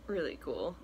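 ordinary talk, nothing marked

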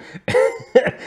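airy wheezing laugh; breathy laugh. Real, not acted. Laughs at Joker copyright idea.

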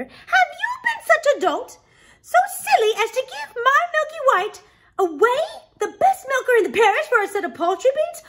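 high, loud, scolding tirade; furious mother. Acted. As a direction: rail at a foolish son in outraged disbelief.

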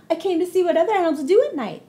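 high, loud and fast; excited bunny. Acted. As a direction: blurt it out eagerly. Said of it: "he said".

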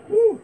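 high, loud whoop. Real, not acted. Vlogger whooping to his viewers on the Vegas Strip, loud high whoop. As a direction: let out a loud whooping cheer.

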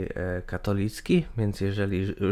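ordinary talk

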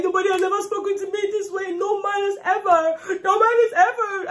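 high, wailing mock sob; mock crying housemate. Vlogger mimics the crying housemate in a wailing mock sob. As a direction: ham up her tearful protest.

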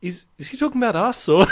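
high, loud puzzled question; mock alarmed classmates; confused kids. Schoolkids asking the teacher, puzzled and high. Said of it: "we're like".

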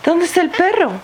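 put-on high sing-song baby talk; reading to a toddler. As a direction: ask playfully, way up high. Reads picture book to toddler.

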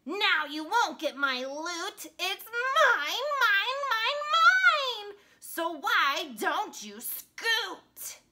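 loud, high greedy pug tantrum; hammed-up storybook shouting; possessive shouting. A narrator's reading. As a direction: yell it, climbing to a peak on each mine. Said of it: "shouted Pig".

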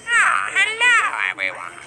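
lightly voiced sly fox; devious fox. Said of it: "I'm a devious fox".

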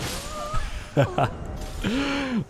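breathy laugh rising very high; delighted laugh. Real, not acted. Player loving his claw hit, high breathy laugh. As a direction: laugh until it goes high.

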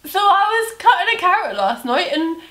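put-on Brummie accent. Imitating a youtuber, in a Brummie accent. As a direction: Brummie accent telling a silly anecdote. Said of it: "What's the accent".

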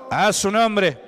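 grita a la congregación, más fuerte; llama fuerte para que respondan; llamado fuerte. Real, not acted. (el pastor grita a la congregación, fuerte)

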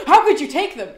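loud, high, shocked question; gasping disbelief. Shown as a demonstration. The wrong way: shocked outcry at the captive. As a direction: gasp and blurt it out in disbelief. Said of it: "the way that he's saying it is not like a".

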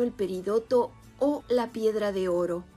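ordinary delivery

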